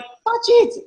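very high squeaky mock demand; shrill-ish kid voice insisting; pushy street kid. Boy insisting again.

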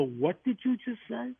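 retold incredulous what did you just say; stunned disbelief. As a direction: pitch jumps up high in shocked disbelief. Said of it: "I go".

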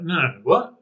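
acted-out confused objection; baffled protest. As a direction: protest in mild disbelief. Acts out reply to accusation.

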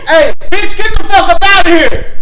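loud high retold yell; mock yell. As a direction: bark it like kicking someone out. Retold yelling at woman.

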